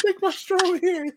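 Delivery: high-pitched goofy put-on voice; mock creepy character. Co-host repeating the small-hands joke, in a mock high voice. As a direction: squeak it out as a running gag. Repeats the comic bit amid laughter.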